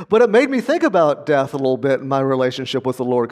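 ordinary delivery

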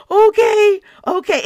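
high, loud mock-startled okay; yielding outburst. Answering the Lord's bold words, high startled yielding. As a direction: burst out high, then ease off.

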